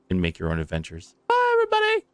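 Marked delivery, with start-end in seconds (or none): 1.3-2.0 very high squeaky sign-off voice; squeaky goodbye. Pretend.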